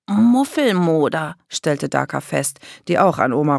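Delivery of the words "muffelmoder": slow, high, stretched word; exaggerated musty-smell pronouncement; disgusted verdict. A narrator's reading. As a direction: draw the word out with comic disgust. Daka names a musty smell.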